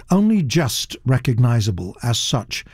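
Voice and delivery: ordinary delivery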